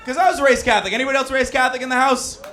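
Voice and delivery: high, loud shouted question; rallying the crowd. Real, not acted. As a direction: holler it out to the whole room.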